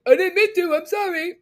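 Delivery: high, loud mock voice of Tom; lightly voiced sing-song fake apology. Imitating Tom's hollow apology to his cohost, high and mocking. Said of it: "He's gonna be like".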